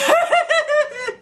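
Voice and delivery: high giggling fit; burst of laughter. Real, not acted. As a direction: crack up again. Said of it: "I can't do it".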